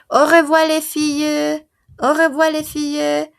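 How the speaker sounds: teacher models Au revoir, les filles loudly to the class; loud pronunciation model. Shown as a demonstration. Teacher corrects a student's goodbye, loud clear model. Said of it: "en francés se pone un artículo".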